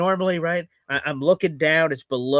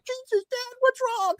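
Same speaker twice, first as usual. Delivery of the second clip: a much higher, worried mock voice; family member's alarm; 5 dB quieter than usual. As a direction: play a startled kid asking dad. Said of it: "the family's like".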